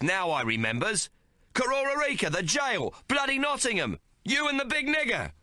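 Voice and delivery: high excited cry of recognition; cries out. Acted. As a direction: shout it as it comes back to you. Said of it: "Syrup cries out".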